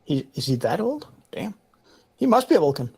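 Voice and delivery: ordinary delivery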